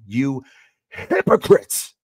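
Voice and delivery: mocking rebuke, hypocrites drawn out slowly and high; shouted hypocrites. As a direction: spit out the word hypocrites like a shouted accusation. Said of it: "He shouts at him".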